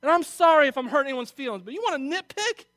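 loud, high, worked-up preaching; emotional outburst. Real, not acted. Pastor scolding the congregation, emotional and loud. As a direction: let the feeling push the voice up and out. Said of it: "I'm emotional this morning".

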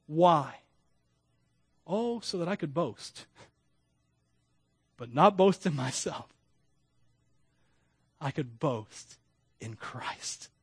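usual speaking voice

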